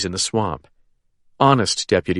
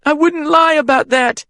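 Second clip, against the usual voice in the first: tearful pleading; 4 dB louder than usual. A narrator's reading. Caitlin pleading, continuing sobbing.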